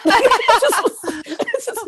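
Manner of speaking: high burst of laughter, then words; real laugh. Real, not acted. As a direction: laugh it out before speaking. Laughs recalling nomination.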